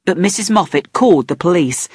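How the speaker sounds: usual speaking voice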